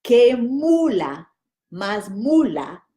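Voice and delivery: very slow, syllable by syllable; slow practice. Shown as a demonstration. Teacher repeating the twister very slowly for students. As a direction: drill the twister at half speed. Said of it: "One more time".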